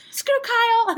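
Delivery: high, loud scoff; dismissive. Retold or imitated. Teller brushes off the missing friend, high mock scoff. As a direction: toss him off with a laugh. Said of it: "And I was like".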